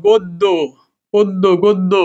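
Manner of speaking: গদ্য, পদ্য as "god-do, pod-do"; doubled d. Shown as a demonstration. Teacher drilling examples, গদ্য and পদ্য with doubled d. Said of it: "গয়কারে গো".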